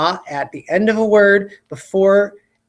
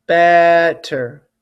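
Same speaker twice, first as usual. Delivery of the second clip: BET-ter, louder first syllable; stress on BET; 4 dB louder than usual. Shown as a demonstration. Teacher repeats the word, first syllable stressed. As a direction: punch the "bet" in "better". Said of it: "Which part is the E in?".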